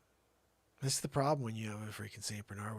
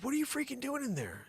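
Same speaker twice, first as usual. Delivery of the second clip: much higher, astonished question; incredulous. Retold or imitated. Father asking daughter inside the dog, high disbelief. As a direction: disbelief at what he sees.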